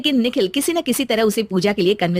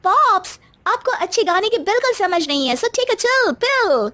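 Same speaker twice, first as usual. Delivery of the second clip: put-on high, drawn-out bratty teen; spoilt daughter voice; 11 semitones higher than usual. Daughter brushing off her father, high and bratty. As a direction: sass your old-fashioned dad from up high.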